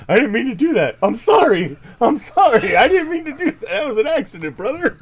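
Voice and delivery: hammed-up sorry from a wrestler caught cheating; Lone Star apologizing. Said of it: "Lone Star's like".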